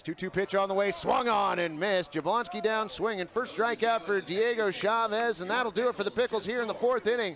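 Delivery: lively radio baseball call; play-by-play. Acted. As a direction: call the action live.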